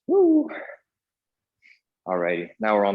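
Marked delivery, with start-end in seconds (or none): 0.1-0.5 excited cheer. Real, not acted. Cheer after finishing a round.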